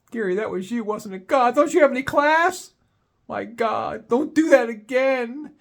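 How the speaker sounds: high, hissed scolding female voice; scolding date. Retold or imitated. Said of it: "she's not saying it really loud".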